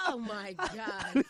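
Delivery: slow, high, breaking up with laughter; laughing hard. Real, not acted. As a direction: gasp it out through real laughter. Helpless laughter at the joke.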